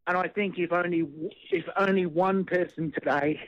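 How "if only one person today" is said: normal talking voice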